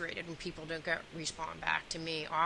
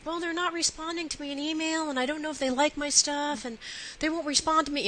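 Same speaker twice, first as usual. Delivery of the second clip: imitating her distraught son, pitched much higher; higher teen voice, complaining about the email; upset teen; 10 semitones higher, 4 dB louder than usual. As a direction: play the whiny, upset thirteen-year-old. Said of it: "he was just all distraught".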